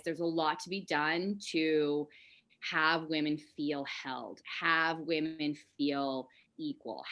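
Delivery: usual speaking voice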